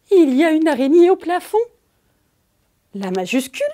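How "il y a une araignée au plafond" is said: a little higher and louder, a pretend startled cry; mock fear. Shown as a demonstration. Teacher playing frightened to show an exclamation. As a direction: act out a light scare at the spider. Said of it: "Elle peut aussi exprimer la peur".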